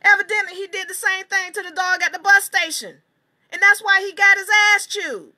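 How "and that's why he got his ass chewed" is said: nail the point home; fired-up declaration. Real, not acted. Righteous conclusion about the poodle.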